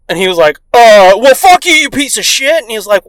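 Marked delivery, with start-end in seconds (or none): 0.7-2.6 loud, high-pitched comeback; mock angry cursing. Neighbor answers dad's axe, mock angry. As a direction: imitate a neighbor flinging insults.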